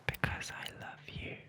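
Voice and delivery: breathy whispered confession; hushed lovesick whisper. Acted. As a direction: whisper it close, as if only she may hear.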